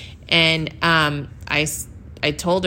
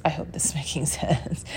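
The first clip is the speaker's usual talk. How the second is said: hushed, laughing whisper; self-conscious half-laugh; 8 dB quieter than usual. Real, not acted. Podcaster checking she makes sense, half-laughing whisper. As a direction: let it dissolve into a quiet laugh.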